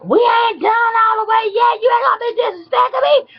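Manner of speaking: mocking high-pitched screech; shrill, hurried holler; hollering angry woman. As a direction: imitate her screaming down the street. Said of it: "she showed her ass out there".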